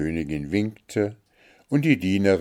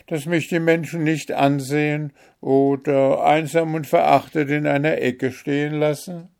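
high, plaintive pleading voice; raised-pitch worried question; anxious fairy-tale daughter; 9 semitones higher, 7 dB louder than usual. Acted. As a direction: appeal to your mother with worry. Story figure Märchen pleads with mother.

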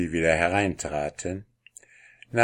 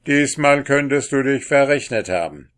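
louder, higher, gruff warning; 6 semitones higher, 7 dB louder than usual. Acted. The king warns Pechvogel, gruff and raised. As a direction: warn him bluntly. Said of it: "unterbrach ihn der König barsch".

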